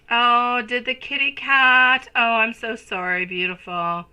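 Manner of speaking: a rising, slowed-down gasp of sympathy; loud, drawn-out sympathetic oh; dismayed sympathy. Real, not acted. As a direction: react with real shock and sorrow at the news. Hearing a viewer's cat died.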